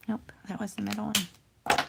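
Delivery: normal talking voice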